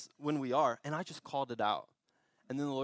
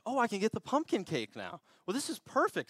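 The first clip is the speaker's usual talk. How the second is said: high-pitched mock excitement; acted delight; 8 semitones higher than usual. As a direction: replay a happy realization, pitched up high. Acted delight at getting cake.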